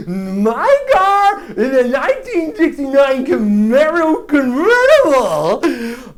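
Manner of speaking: slow, drawn-out, exaggerated kid voice; mock kid voice. Weakest kid defending his car to a trader, drawn-out mock kid voice. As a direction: stretch each word as the indignant little kid.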